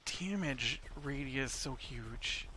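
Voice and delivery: ordinary talk, nothing marked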